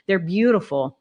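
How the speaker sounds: ordinary delivery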